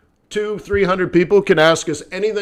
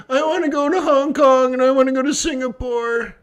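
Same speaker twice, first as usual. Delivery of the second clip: higher, put-on voice, eager; mock inner voice; 7 semitones higher than usual. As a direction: voice his wish like a giddy kid. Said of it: "another way of saying".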